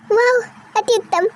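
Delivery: ordinary talk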